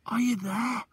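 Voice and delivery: high, loud and slow calling; boy shouting through the night forest. Acted. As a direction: stretch the call out across the woods. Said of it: "I shouted".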